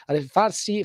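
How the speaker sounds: ordinary talk, nothing marked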